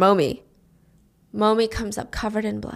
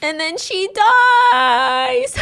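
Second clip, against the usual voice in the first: very high, drawn-out mock wail; hammed-up grief; 12 semitones higher, 4 dB louder, much slower than usual. A narrator's reading. Teller announcing grandma's death, melodramatic wail. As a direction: stretch the last word into a wail.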